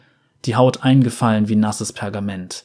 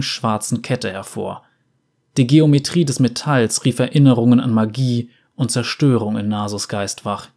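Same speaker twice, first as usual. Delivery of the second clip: ordinary delivery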